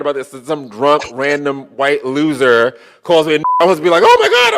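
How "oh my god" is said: much higher, louder amazed exclamation; incredulous oh my god. Retold or imitated. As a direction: shriek of disbelief. Retelling his amused reaction.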